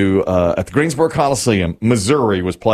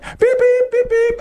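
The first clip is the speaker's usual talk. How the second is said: very high, light little toots; imitating a polite mother's tiny horn; dainty toot imitation. Son imitating his gentle mother tapping the horn, very high. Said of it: "she would say that with her vo".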